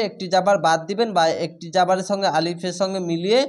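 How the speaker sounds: ordinary talk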